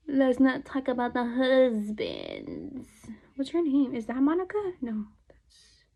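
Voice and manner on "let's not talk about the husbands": dramatic aside. Real, not acted.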